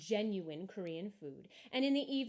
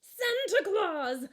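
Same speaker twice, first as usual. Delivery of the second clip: much higher, louder, slow delighted cry; excited cry; 12 semitones higher, 11 dB louder, much slower than usual. A narrator's reading. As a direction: call out in amazed joy. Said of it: "cried the lump of coal".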